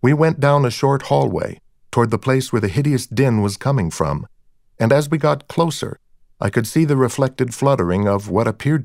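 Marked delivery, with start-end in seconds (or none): none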